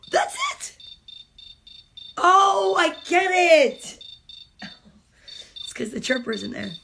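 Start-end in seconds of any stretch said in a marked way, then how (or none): 0.1-0.8 gasped high squeak; surprised. Real, not acted. Mom finding the book ended, shocked squeak. 2.2-4.0 loud high slow realization; realizing. Real, not acted. Mom realizing the ending, loud.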